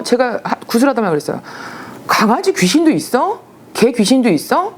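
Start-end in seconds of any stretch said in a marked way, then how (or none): 2.1-4.7 high, incredulous questions; 놀라서 높아진 목소리; startled. Retold or imitated. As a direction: blurt it out in disbelief. Startled at seeing dog ghost.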